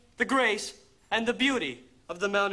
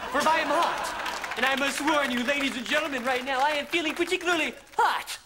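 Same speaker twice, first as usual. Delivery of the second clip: showman swagger; 3 semitones higher than usual. Acted. Comic swagger building to showman.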